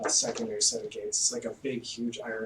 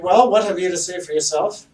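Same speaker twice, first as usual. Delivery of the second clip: high, loud haughty noble voice; pompous mayor; 7 semitones higher, 11 dB louder than usual. Acted. Mayor accusing the party of ruining his silk, haughty and loud. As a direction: look down your nose at them. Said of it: "He's like".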